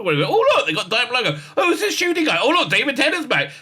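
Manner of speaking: high-pitched mock excited gossip; excited chatter. Acting out people gossiping round the office water cooler, excitedly.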